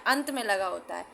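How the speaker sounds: ordinary talk, nothing marked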